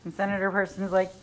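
ordinary delivery

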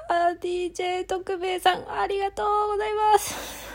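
giddy, high-pitched listener thank-you; overexcited thanks. Real, not acted. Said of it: "すぐテンションが上がってしまう".

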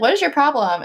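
playful indignation; indignant. Retold or imitated. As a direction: play up being hurt. Her retold indignant reply.

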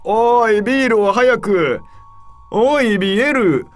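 put-on loud, high Tohoku-accented shouting. A narrator's reading. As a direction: bellow for beer in a Tohoku accent. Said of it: "東北なまりのものもあり".

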